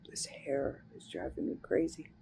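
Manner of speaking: breathy whispered grumble; under-breath aside. Real, not acted. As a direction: whisper it to yourself, annoyed. Muttered aside about her hair.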